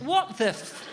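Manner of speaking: put-on high, outraged child voice; a child squealing at the taste. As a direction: play a child betrayed by an olive. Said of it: "it'd go".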